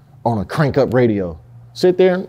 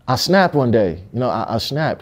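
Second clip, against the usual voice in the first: ordinary delivery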